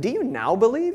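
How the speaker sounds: a touch of sarcasm, rising on believe; sarcastic Jesus. Retold or imitated. Jesus answering the disciples, a hint of sarcasm. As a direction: tease the question lightly. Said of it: "a little touch of sarcasm".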